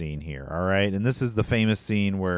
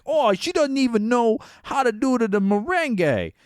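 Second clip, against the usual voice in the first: much higher, mocking New Jersey tough-guy accent; Johnny's odd accent; 13 semitones higher, 4 dB louder than usual. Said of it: "he has this weird ... his accent".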